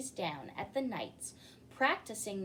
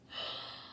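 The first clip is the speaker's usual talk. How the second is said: soft gasp; 8 dB quieter than usual. Acted. Narrator reacts to dragon.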